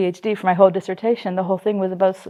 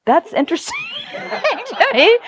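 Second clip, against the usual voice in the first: high, slow, laughing through it; 13 semitones higher, slower than usual. Pretend. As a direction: giggle while teasing the guest. Mock therapist, laughing.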